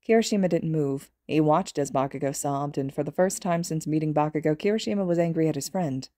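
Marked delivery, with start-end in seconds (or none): none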